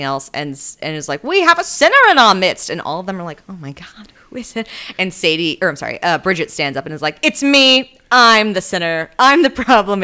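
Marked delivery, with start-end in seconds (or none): 1.2-2.7 high, loud mock pulpit cry; denouncing bishop. Bishop denouncing a sinner in church. 3.5-4.7 scandalized mock whisper; hushed shock. Shocked congregation whispering among themselves. 7.2-10.0 loud, raised mock declaration; dramatic confession. Bridget dramatically confesses before the church.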